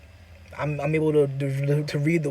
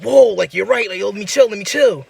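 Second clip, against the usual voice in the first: higher, startled retreat; alarmed backing down; 5 semitones higher, 5 dB louder than usual. Retold or imitated. Dreamer answering the person's warning, alarmed and backing off. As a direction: back off fast, voice raised in retold alarm.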